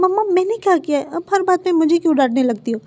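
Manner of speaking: high, indignant whine at mom; whiny complaint. A narrator's reading. Son whining to his scolding mother, high and indignant. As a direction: protest like a wronged teenager.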